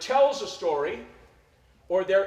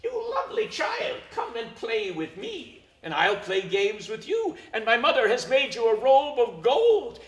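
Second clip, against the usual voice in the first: high, sweet luring spirit voice that starts far higher; Erlking's voice; 3 semitones higher than usual. Acted. Erlking lures the child away from his father, high and sweet. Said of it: "you hear the voice of the Erlking".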